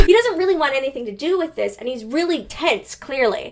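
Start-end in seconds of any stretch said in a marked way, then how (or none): none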